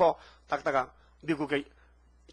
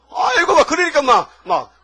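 high, loud 아이고 bursting out; overwhelmed cry; 9 semitones higher, 10 dB louder than usual. Retold or imitated. Retelling the wife reading her husband's note, overwhelmed cry. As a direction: cry out, overcome with emotion.

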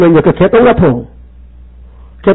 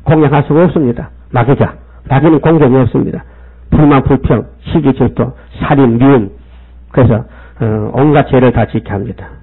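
usual speaking voice

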